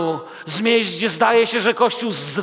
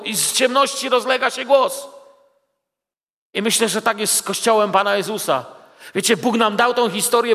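ordinary talk, nothing marked

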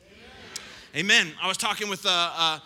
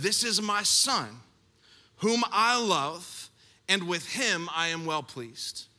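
slow solemn; 2 semitones lower, slower than usual. Acted. Reading God's voice from heaven.

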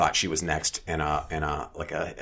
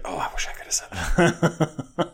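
slow, higher, laughing aside; wishful counter guy; 8 semitones higher, slower than usual. Retold or imitated. Clerk silently cheering him on, slow and laughing. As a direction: play the smirking clerk's thought. Voicing counter guy's smirk.

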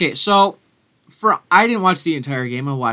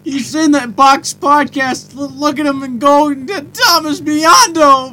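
mock gushing announcer; 9 semitones higher, 5 dB louder, slower than usual. Mocking the first intro.

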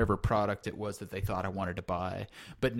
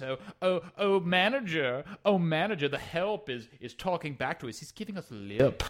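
higher, louder mock indignant diner; mock snooty guest; 6 semitones higher, 3 dB louder than usual. Snooty diner calling for the manager, mock whining. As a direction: whine for the manager like an entitled customer.

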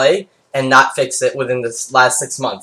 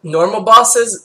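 surprised; 7 semitones higher, slower than usual. Real, not acted. Incredulous echo of question.

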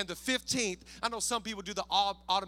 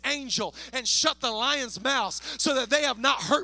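loud, rousing scripture proclamation; full-force preaching; 4 semitones higher, 7 dB louder than usual. Real, not acted. Preacher reading Daniel's answer to the congregation, full-force preaching. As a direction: belt it out to rouse the crowd.

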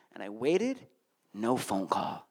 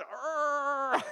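mock monster growl; 9 semitones higher than usual.

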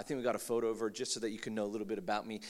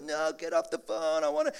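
yelling sibling; 7 dB louder than usual. Retold or imitated. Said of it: "It's like".

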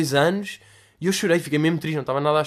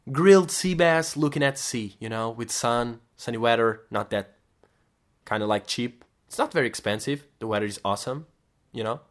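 casual English quote; 3 semitones lower than usual. Retold or imitated. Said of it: "Foi tipo".

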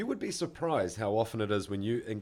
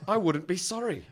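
higher, louder, unrepentant parody; arrogant mock apology; 7 semitones higher, 5 dB louder than usual. Friend mimics someone refusing any blame, mock-arrogant. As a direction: push the fake apology up and out. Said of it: "or an arrogant apology".

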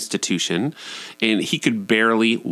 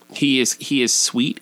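usual speaking voice

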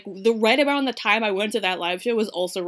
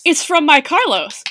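louder, slow and delighted; excited; 4 semitones higher, 8 dB louder than usual. Acted. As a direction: gush over the caller's name. Excited about Carlos calling.

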